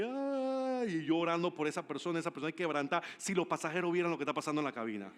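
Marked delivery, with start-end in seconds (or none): 0.0-1.0 long, high mock wail; retold sob, pitched up and stretched; imitated crying. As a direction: draw out a tearful "ay", imitating a weeping man. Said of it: "otro capitán llorando, abrazándome".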